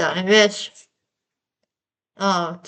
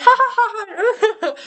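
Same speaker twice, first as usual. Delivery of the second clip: high, slow put-on laugh; mock laughing; 9 semitones higher than usual. Recalling the gas, a high slow mock laugh. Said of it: "I was like the laughing, crying emoji".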